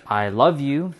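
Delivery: bright, lifted I love you; I love you said loud and bright; excited love you. Shown as a demonstration. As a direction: say it with eager warmth. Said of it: "Same words, but different intention behind it".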